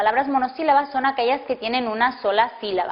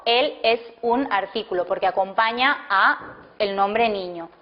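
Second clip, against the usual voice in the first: ordinary talk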